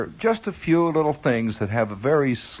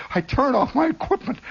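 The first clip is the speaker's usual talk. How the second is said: normal talking voice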